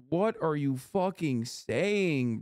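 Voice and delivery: ordinary talk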